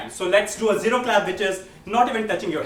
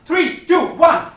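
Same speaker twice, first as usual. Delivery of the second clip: loud, higher countdown to a crowd; countdown cue; 7 semitones higher, 5 dB louder, slower than usual. Real, not acted. Host cues audience section to shout its answer, rising countdown. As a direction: count them in, voice lifting.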